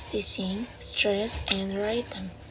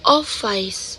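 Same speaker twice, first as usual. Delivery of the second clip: office with OF hit louder; stress on first syllable; 5 dB louder than usual. Shown as a demonstration. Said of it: "the first syllable on the word office".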